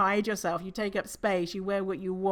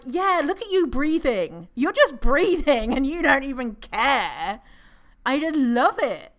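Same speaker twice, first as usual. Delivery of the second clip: gushing delight; 6 semitones higher, 9 dB louder than usual. Acted. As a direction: revel in it, swooping up loudly. Acts gloating delight at panting walkers.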